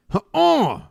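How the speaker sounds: sharp high yelp of disbelief; high disbelieving yelp. Real, not acted. Player yelping high at another bad roll. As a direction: yelp loud and high at the screen.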